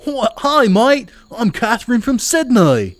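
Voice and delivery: loud, high-pitched hammed-up Aussie greeting; over-the-top Australian character voice; bad Australian accent. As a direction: belt out a cartoonish Australian accent, stretching the words. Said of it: "I don't do a good Australian accent".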